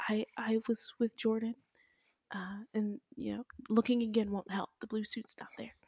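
soft, hushed, overly polite; very respectfully. Retold or imitated. Imitating Layla politely telling Mama Laura, soft and hushed. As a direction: say it gently, almost whispering, all manners. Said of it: "She said very respectfully".

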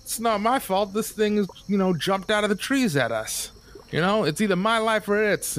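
defensive mercenary. Acted. DM voicing mercenary blamed for chopping the creature. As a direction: protest like a cornered thug.

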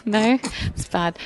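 usual speaking voice

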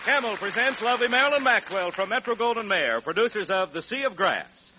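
ordinary delivery

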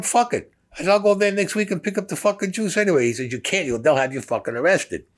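high-pitched, heated back-and-forth; worked-up retelling. Retold or imitated. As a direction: argue it out, voice jumping up. Retold heated exchange after blunder.

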